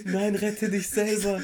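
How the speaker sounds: hammed-up noble self-sacrifice; overacted death scene. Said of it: "Wie so ein Film".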